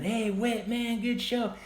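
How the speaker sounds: high-pitched call across the street; yelling out. Retold or imitated. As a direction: shout out to a friend leaving in a taxi. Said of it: "I yelled out to him".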